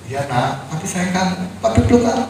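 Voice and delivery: put-on soft, tender reassurance to a child; tender comfort. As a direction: hush him gently like a father. Father comforting dying son. (ayah menenangkan anaknya dengan lembut)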